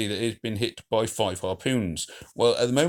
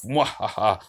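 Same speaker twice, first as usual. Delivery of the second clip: mwahaha, a hammed-up evil laugh; mad scientist laugh; 4 dB louder, slower than usual. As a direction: gloat with a mock villain cackle. Gloating over the harpooned Titan.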